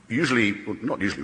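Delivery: ordinary delivery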